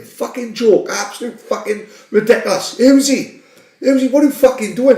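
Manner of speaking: high mock rant; a hint of a furious Ferguson tearing into players; imitating a raging manager. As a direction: rant like a furious gaffer, half-hammed. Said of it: "He's fucking raging".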